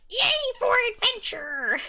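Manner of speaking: high, cheery little voice; Captain Toad cheer. Retold or imitated. Captain Toad cheering for adventure, high and bright. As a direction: squeak it like an eager explorer. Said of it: "He's like".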